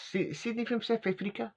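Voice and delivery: put-on high, questioning South African accent on Sydney. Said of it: "I can't keep doing his accent".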